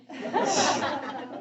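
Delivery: a big real laugh; loud laugh. Real, not acted. Teacher laughs with the class about eyebrows, loud. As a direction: laugh out at your own joke.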